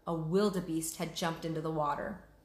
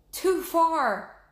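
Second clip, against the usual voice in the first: higher, louder, out of breath from straining; breathing hard; 10 semitones higher, 8 dB louder than usual. Acted. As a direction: gasp the words out while reaching. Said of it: "breathing hard".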